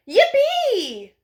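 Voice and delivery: over the moon about his very first bike, a happy cry; excited cry. A narrator's reading. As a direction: let out a thrilled little-kid yippee. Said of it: "cried Froggy".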